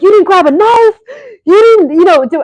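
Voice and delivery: can't believe she didn't grab a knife; incredulous outburst. Retold or imitated. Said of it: "And I'm like".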